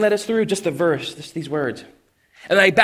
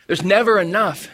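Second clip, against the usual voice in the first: normal talking voice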